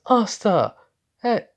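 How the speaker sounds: shouted call across a distance. Acted. Dante spots Asta and calls out loudly to him. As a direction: yell his name to catch his attention.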